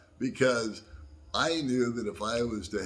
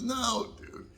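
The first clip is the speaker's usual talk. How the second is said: laughing retelling. Retold or imitated. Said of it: "He's laughing at me".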